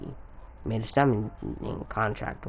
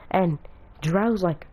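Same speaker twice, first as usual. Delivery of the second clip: ordinary delivery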